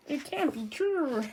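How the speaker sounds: high, drawn-out cry of disbelief; distraught girl voice. Acted. Storyteller voicing Otama refusing bad news, high slow wail. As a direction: wail it slowly as a shocked girl. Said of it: "I do my voices".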